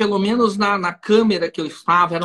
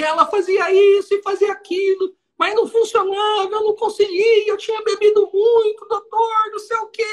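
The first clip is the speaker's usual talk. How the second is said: much higher, crying voice, hammed up; mock tearful defendant; 12 semitones higher than usual. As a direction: play the defendant sobbing to the judge. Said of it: "Em prantos".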